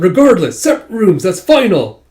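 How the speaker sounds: loud, high, flustered shout; acted scream, emphatic on each phrase; screamed decree. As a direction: yell it to shut the argument down. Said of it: "he just screams out".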